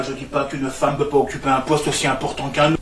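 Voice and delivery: normal talking voice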